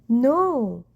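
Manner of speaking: a sharp, alarmed cry; cried out. Acted. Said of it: "cried the witch".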